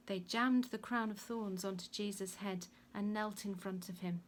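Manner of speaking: usual speaking voice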